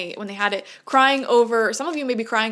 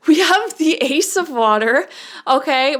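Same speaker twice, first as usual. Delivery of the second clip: excited reveal; 5 semitones higher, 6 dB louder than usual. Real, not acted. Excited reveal of better card.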